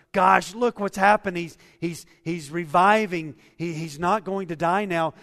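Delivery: loud, joyful outburst of relief; celebrating household. Acted. Servants celebrating the boy's recovery, excited shouting. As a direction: burst out with happy disbelief.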